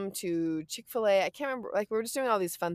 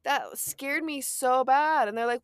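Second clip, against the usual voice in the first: ordinary talk, nothing marked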